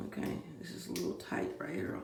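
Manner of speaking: unvoiced half-whisper to herself; whispered aside. Real, not acted. Taking her hair down, whispering to herself. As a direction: murmur while fiddling with her hair.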